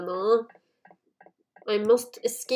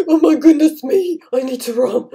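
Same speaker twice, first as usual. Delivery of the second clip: loud, raised panicky cry; panicked; 5 semitones higher, 11 dB louder than usual. Real, not acted. Player needs to run away, loud panic. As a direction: cry out while fleeing.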